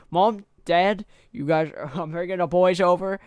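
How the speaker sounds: loud, high Australian accent impression; imitated Australian accent. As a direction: call out like an exaggerated Australian. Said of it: "then the Australians are like".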